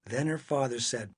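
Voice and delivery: plain reading voice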